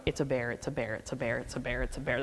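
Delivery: softly repeated in a frozen daze; breathless repeating. Retold or imitated. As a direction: murmur it over and over, barely breathing. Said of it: "I'm not breathing, I'm just repeating".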